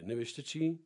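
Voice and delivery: ordinary talk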